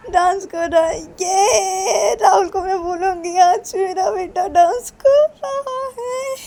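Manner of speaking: high, loud delighted squeals. Acted. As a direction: gush with joy, near tears at the end. Mother delighted child dances. (बच्चे का नाच देखकर ऊँची आवाज़ में ख़ुशी से चहकती माँ)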